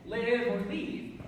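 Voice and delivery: Live and leave drawn out, weighing each word; live or leave, slow. Shown as a demonstration. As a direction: say Live and leave slowly, one against the other. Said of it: "Is she saying live while you can or leave".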